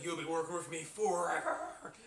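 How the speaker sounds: lightly voiced, slow Krabs threat; Mister Krabs. Fan quoting Mister Krabs to his workers, mild threat. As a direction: drag it out with menace. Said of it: "Mister Krabs says".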